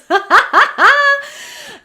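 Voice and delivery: a big, high burst of real laughter; loud laugh. Real, not acted. Laughing at a clip a viewer sent her. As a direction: laugh out loud, caught off guard.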